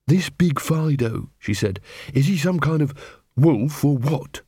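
slow, wary questioning; Angua probing. A narrator's reading. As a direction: ask carefully, slowly.

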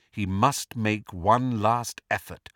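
ordinary delivery, nothing marked

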